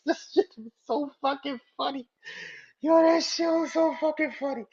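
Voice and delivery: high squeaky voice through laughter; laughing helplessly. Real, not acted. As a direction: squeeze the words out while cracking up. Can barely speak for laughing.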